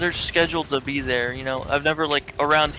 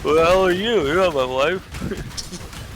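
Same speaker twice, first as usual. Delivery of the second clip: mixed cartoon voice; 3 semitones higher, 3 dB louder, much slower than usual. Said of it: "I'm doing another dimension".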